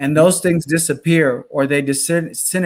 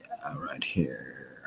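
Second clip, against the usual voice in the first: whispered aside; 13 semitones lower, 18 dB quieter, much slower than usual. Real, not acted. Quiet aside during camera switch.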